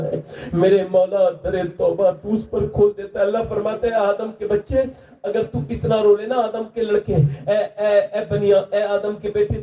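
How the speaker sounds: high, impassioned pleading sermon; preacher pleading with the young men; preaching at full force. Acted. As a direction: build the crowd toward repentance. Preacher voicing God at the climax.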